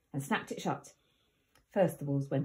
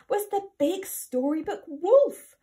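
ordinary delivery, nothing marked

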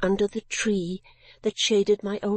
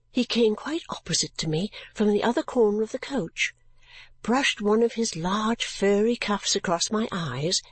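ordinary delivery

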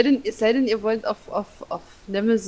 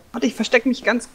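usual speaking voice

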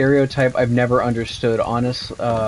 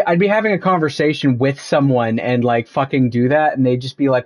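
ordinary delivery

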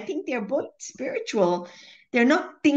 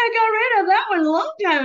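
laughing mock dismay; 10 semitones higher, 8 dB louder, faster than usual. Laughing to Tomo about a thought that came back. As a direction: groan through a laugh at the returning thought.

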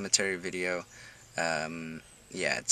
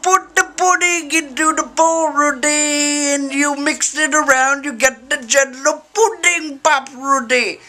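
slow, much higher, loud rambling comic voice; Bill Cosby impression; 8 dB louder than usual. Vlogger drifts into a sitcom dad voice while friends listen, slow and loud. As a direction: drawl it out slowly like a sitcom dad. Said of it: "went into a little bit of Bill Cosby there".

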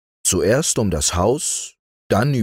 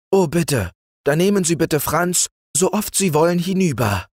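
high, obliging plea; lightly acted widow, pitched up; pleading; 10 semitones higher than usual. A narrator's reading. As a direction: offer it eagerly as a polite request. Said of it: "bat Frau Martha".

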